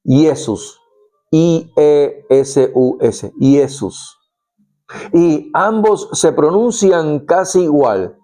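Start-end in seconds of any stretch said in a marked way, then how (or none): 0.0-4.2 Iesus said as YEH-soos, then spelt I E S U S letter by letter; Latin pronunciation. Shown as a demonstration. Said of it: "En latín se le dice".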